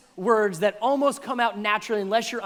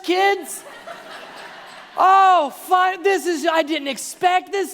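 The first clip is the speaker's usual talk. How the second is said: high, loud, overjoyed mock retelling; hammed-up delight, almost shouting; excited retelling; 10 semitones higher, 7 dB louder than usual. Preacher acting out a woman's joy at a gift card, loud and high.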